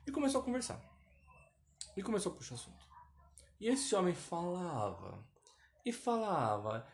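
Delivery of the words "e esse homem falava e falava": "falava" stretched long and slow; drawn-out emphasis. Retold or imitated. Telling of an endless talker, drawn out. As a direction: drag out each "falava" wearily long.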